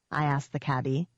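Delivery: plain reading voice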